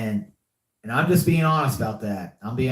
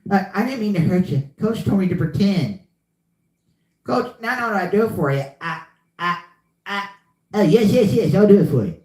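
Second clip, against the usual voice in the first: higher, fast, stammering simpleton voice; Water Boy impression; 5 semitones higher, faster than usual. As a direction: stammer it out eager to please coach. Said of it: "I did the water boy".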